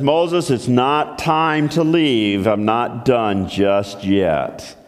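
slow, low calm reply; unhurried low divine voice; patient voice of God. Acted. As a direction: hold him back gently. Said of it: "a voice comes back and says".

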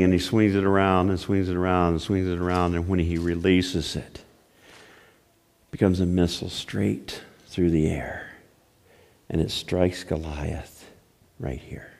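soft, low storytelling; hushed suspense. A narrator's reading. Preacher telling of the stone striking Goliath, hushed suspense.

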